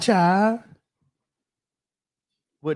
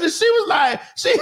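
usual speaking voice

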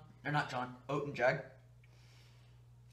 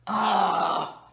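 loud anguished yell at the sour taste; pained scream; 4 semitones higher, 7 dB louder than usual. Real, not acted. Reacting to sour lemon juice.

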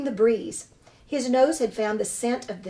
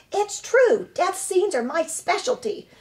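high, showy actress voice; proud theatrical opossum; 9 semitones higher, 3 dB louder than usual. Acted. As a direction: boast like a grand stage diva. Opossum boasts of death scenes.

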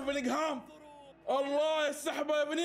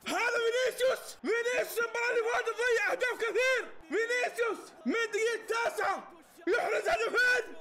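ecstatic laughing shout; 9 semitones higher than usual. Real, not acted. Cheering Vinicius's two goals, high laughing shouts. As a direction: scream with delight between laughs.

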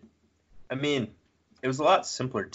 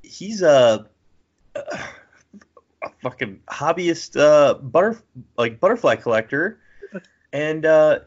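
pitched-up, hesitant presenter voice; mock TV show announcer; 7 semitones higher, 5 dB louder, slower than usual. Mocking a House Hunters couple introduction, high announcer voice. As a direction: present the contestants in a raised, sing-out voice.

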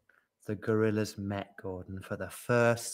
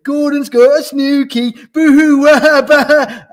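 very loud, high mock wailing; hysterical babbling; 17 dB louder than usual. A narrator's reading. Yak carries on at the gossip, hysterical babbling. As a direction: babble into hammed-up boo-hoos. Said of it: "babbled the yak".

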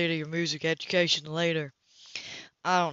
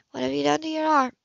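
higher, cool woman's voice, caught off guard; higher, cooler woman's voice to Cam at her door; Anna's voice; 9 semitones higher, 6 dB louder than usual. Acted. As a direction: play the composed woman surprised at her door. Anna asking.

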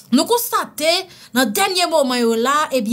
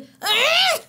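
hiccup; 14 semitones higher than usual. Acted. Hiccup sound.

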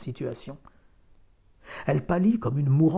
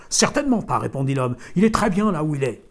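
curt loud refusal; 3 semitones higher, 8 dB louder than usual. A narrator's reading. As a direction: shut her down. Farmer refusing loudly.

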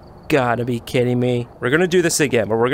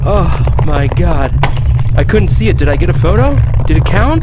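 loud breathless disbelief; shaken shouting; 6 semitones higher, 9 dB louder than usual. Real, not acted. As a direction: still rattled, yelling. Aftermath of scare, shouting.

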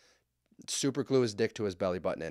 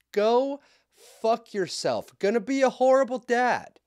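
much higher, louder angry outburst; furious shout; 13 semitones higher, 7 dB louder than usual. Real, not acted. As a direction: blow up at the selfish husband. Exploding at the husband.

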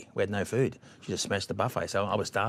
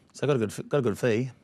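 ordinary delivery

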